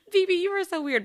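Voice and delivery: high and loud, teasing herself; mock self-scolding. Telling herself off for poking a bruise, mock scolding. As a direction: scold yourself, half laughing. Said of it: "And I'm like".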